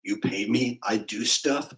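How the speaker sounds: ordinary delivery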